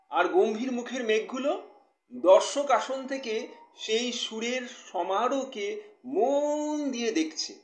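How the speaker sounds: expressive poetry reading with measured pauses; poem recitation. Acted. Poet reading a new poem to live viewers, expressive recitation. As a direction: recite it line by line, letting key words swell.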